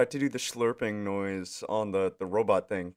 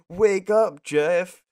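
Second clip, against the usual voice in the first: loud call to wake someone; calling to wake him; 7 semitones higher, 8 dB louder, much slower than usual. Retold or imitated. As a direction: shout it to rouse a sleeper. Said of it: "where they'd be like".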